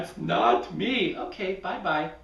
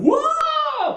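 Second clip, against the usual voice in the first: high, loud whoa with a laugh; startled yelp; 7 dB louder than usual. Acted. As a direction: cry out as you topple. Falling while climbing.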